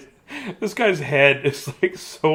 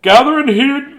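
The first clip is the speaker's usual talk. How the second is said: knight voice; 9 semitones higher, 3 dB louder than usual. Acted. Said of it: "I am King Knightly".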